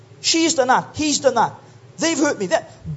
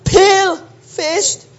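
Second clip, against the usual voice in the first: drawn-out punchline; 4 semitones higher, much slower than usual. Acted.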